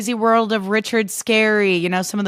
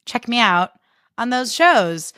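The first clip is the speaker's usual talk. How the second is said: ordinary talk, nothing marked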